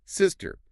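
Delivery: SISTER with a high-pitched first syllable, falling; first-syllable stress. Shown as a demonstration. Teacher modelling a family word, stressed on the first part. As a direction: hit the SIS of "sister" high. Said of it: "Stress on the first part".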